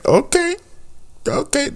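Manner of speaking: high, rising delighted okays; playful astonished okay; mock amazement. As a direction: squeal the okays in mock awe. Mock-excited reaction to the line.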